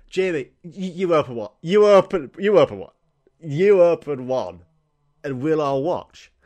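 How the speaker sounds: loud insistent urging, slowing at the end; pushy family. Retold or imitated. Family pushing the kid to open first, insistent.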